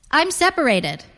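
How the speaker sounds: ordinary delivery, nothing marked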